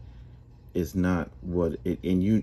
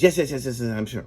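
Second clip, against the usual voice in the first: eager, louder, higher rapid yeses; 6 semitones higher, 5 dB louder, faster than usual. Acted. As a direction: blurt the yeses out, desperate to agree. Harold eagerly accepts going downstairs.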